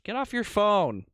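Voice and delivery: high, loud, drawn-out call; real scolding shout. Real, not acted. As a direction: cut in and yell at a distracted friend. Breaks off to scold companion.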